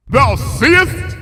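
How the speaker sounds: loud, high triumphant howl. Acted. Luna showing Twilight the hill, howling. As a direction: fling it to the wind. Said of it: "howled Luna".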